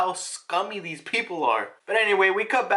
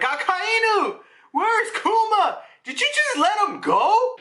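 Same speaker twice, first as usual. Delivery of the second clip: much higher, slow, outraged whine; shrill spoiled noble; 13 semitones higher, 4 dB louder, slower than usual. Retold or imitated. Nobles demanding answers from Akainu, high whining outrage. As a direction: squeal at him like an offended aristocrat. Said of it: "They're like".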